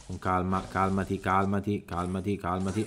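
hushed reassuring murmur to a jittery teammate; soothing hush. Real, not acted. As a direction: settle him down gently.

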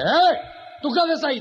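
loud, high challenge; sergeant calling up. Acted. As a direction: shout up at someone far above. Sergeant calls up to statue.

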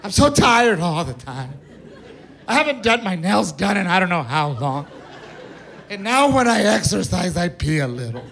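a hint of a sobbing wife; crying wife. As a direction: play the tearful wife with a touch of whine. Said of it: "She goes".

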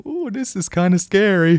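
high, loud mock spooked ooh; high, loud mock-scared ooh. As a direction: gasp it out like a pretend scare. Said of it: "the noises should be weird".

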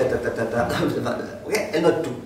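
ordinary delivery

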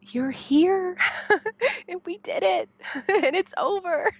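high, laughing, tearful relief; mother greeting her newborn; laughing through tears. Real, not acted. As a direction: laugh and cry at once.

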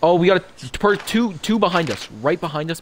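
sudden sharp alert, high and loud; urgent callout. Real, not acted. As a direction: call out the enemies fast. Enemies spotted, urgent callout.

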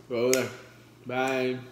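loud sharp shoo; shooing command. Real, not acted. Vlogger shoos her dog away, loud. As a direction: push the dog away with your voice.

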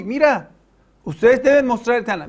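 a light take on a smug challenger; mock demand. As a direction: mimic their challenge, pressing. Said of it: "Tú dices, ellos dicen".

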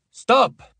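high, loud, commanding call; authoritative shout. A narrator's reading. Fake Leo halts all the kids, authoritative shout. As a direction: shout it as through a megaphone, with full authority. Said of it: "gridò".

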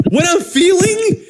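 high, drawn-out incredulous yelp; outraged echo. Retold or imitated. Patient to doctor mid-procedure, outraged disbelief. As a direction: throw the question back in mock outrage.

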